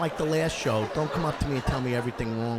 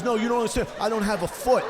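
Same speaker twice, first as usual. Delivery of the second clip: insisting; 8 semitones higher, 4 dB louder, faster than usual. Retold or imitated. Fan insisting.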